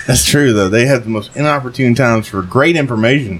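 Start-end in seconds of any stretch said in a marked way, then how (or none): none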